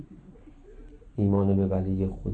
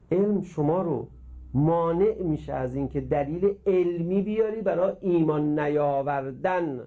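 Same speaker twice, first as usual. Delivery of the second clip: ordinary delivery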